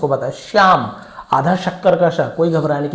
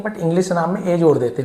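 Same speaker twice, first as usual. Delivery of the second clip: ordinary talk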